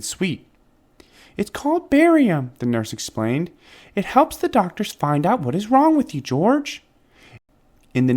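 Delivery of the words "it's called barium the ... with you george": high, bright-voiced nurse explaining gently. Nurse explaining the barium drink to George, higher and cheerful.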